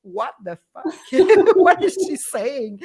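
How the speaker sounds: mock bewilderment broken by laughter; baffled coworkers, laughing. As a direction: play their confusion while cracking up. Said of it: "everybody will say".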